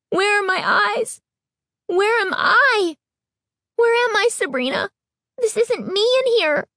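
very high, pleading cries; hysterical panic. A narrator's reading. Girl lost in her own face, very high hysterical pleas. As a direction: spiral into hysteria.

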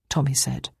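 ordinary delivery

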